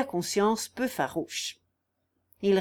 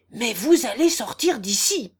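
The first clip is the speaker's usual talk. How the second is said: raised voice, ordering him out; angry cry; 2 semitones higher, 6 dB louder than usual. A narrator's reading. Guillaume orders Raguet out, crying out loudly. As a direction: throw him out with a loud, emphatic cry. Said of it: "s'écria Guillaume".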